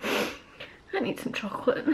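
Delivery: very soft, slow, voiceless murmur; dejected near-whisper. Real, not acted. Upset patient wanting comfort after bad news, dejected whisper. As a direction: say it under your breath, slowly. Upset, speaks under her breath.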